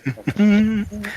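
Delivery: soft high little hum; quiet, high humming to oneself. Acted. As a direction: hum a little tune, softly. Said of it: "familiar little humming thing".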